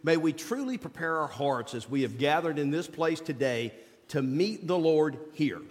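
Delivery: ordinary delivery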